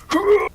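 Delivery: high, loud grunt. Acted. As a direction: let out a sudden loud "ugh". Effortful grunt over big damage.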